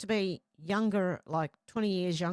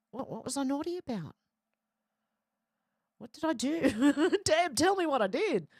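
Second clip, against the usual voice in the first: mock innocent protest; 7 semitones higher than usual. Real, not acted. Teased as naughty in chat.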